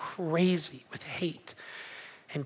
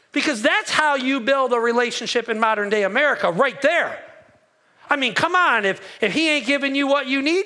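sarcastic preacher; 2 semitones higher than usual. Acted. Pastor mocking the congregation with sarcastic advice. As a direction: preach it dripping with sarcasm, tongue in cheek. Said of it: "It's gonna be Pastor Jay, the sarcastic preacher".

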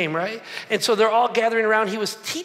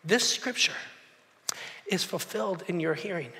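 soft, hushed pronouncement; quiet solemn Jesus; 4 semitones lower, 8 dB quieter than usual. Retold or imitated. Preacher voicing Jesus declaring the scripture fulfilled, softly. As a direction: let it land in silence. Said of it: "he says".

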